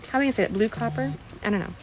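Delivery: usual speaking voice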